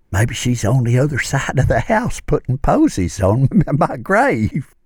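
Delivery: high, giggly voice with a laugh; giggling whisper. Acted. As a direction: giggle through the words. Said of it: "still whispering, but giggling".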